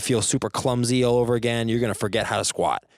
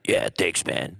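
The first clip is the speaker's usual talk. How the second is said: low, throaty mock reply from a big guy; throaty gym guy; 5 semitones lower, slower than usual. No-neck lifter thanking a compliment, throaty mock voice. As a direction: gulp the words out as if without a throat. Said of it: "this disgusting throaty".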